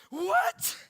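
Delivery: high, loud gangster challenge; sharp incredulous yell; confrontational Holy Spirit. Retold or imitated. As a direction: shout it like squaring up to someone. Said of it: "he stood like a gangster beside me".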